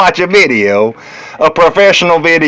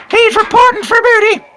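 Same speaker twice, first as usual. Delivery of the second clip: squealed high voice. Acted. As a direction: squeal it like a cartoon.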